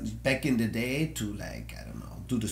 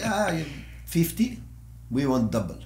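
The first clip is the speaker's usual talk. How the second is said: put-on Arabic accent; 4 semitones higher than usual. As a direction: play it as an Arabic-accented showman. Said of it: "your Arabic accent".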